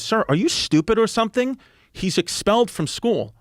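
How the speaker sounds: imitated indignation, pitched well up; high, incredulous mock scolding; exasperated principal. Brother Rob to the father, retold in high exasperation. Principal snaps back at the dad.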